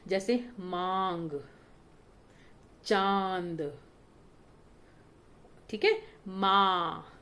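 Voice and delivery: मांग, चांद, माँ slow, vowel sounded through mouth and nose; nasal chandrabindu examples. Shown as a demonstration. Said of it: "मुख और नाक दोनों से इसकी आवाज आती है". (मांग, चांद, माँ धीरे, मुँह और नाक दोनों से)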